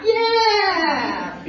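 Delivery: high, loud hammed-up cry; mock sob. Bo Peep confirming her sheep is lost, in a loud mock sob. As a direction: wail it comically.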